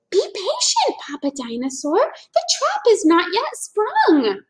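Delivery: high, patient sly dinosaur; Mama Dinosaur. A narrator's reading. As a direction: hold back a hungry partner.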